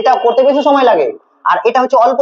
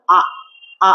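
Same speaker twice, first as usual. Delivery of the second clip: আ said very briefly; short vowel; 3 semitones lower than usual. Shown as a demonstration. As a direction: cut the sound আ short, with a pause after it. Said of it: "আটা উচ্চারণ করতে বেশি সময় লাগে না". (আ অল্প সময়ে ছোট করে উচ্চারণ)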